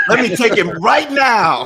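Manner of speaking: loud, high, drawn-out divine proclamation; hammed-up voice from on high, slow and loud; God. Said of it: "God is taking you right now".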